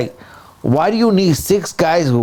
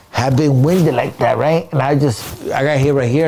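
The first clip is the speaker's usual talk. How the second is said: ordinary talk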